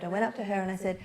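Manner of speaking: ordinary talk, nothing marked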